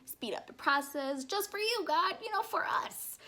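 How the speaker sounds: rising into a high laughing squeak; laughing joke. Retold or imitated. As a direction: tease yourself with a grin. Joking about helping God.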